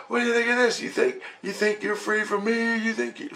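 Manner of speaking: high, laughing taunt. Retold or imitated. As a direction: sneer it out with a cackle. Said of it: "And he's going".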